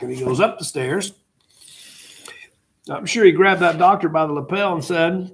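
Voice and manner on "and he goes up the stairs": ordinary talk, nothing marked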